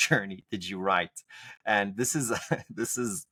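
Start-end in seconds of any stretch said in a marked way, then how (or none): 1.7-3.3 real laugh breaking into the words; stifle a giggle as you start; trying not to laugh. Real, not acted. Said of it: "I can't say this without laughing".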